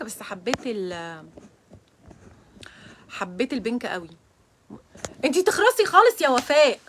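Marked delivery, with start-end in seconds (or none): 5.2-6.8 loud playful shut-up to a friend; mock scolding. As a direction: tell her off loudly, half joking. Joking scolding at friend Wafaa.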